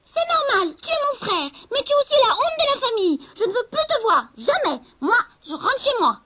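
scornful little witch-cat; haughty sister cat. Acted. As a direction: laugh him off as the family shame. Sister cat disowns her brother.

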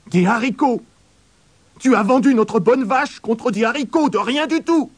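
angry mother. A narrator's reading. Mother angry that the cow went for beans. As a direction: scold him high and outraged. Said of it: "sa mère se fâcha tout rouge".

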